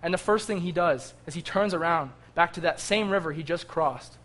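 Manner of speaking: ordinary talk, nothing marked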